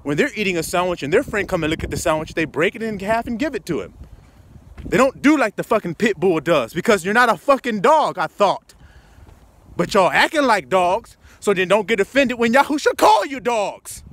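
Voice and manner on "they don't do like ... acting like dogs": usual speaking voice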